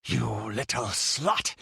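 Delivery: seething, drawn-out insult; slow, breathless menace; panting rage. A narrator's reading. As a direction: spit it out slowly, panting with fury. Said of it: "he panted".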